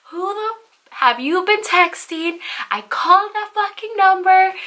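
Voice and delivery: high-pitched, indignant retold outburst; acted blow-up. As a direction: act out confronting a cheater, pitch way up. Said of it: "was gonna blow up right now".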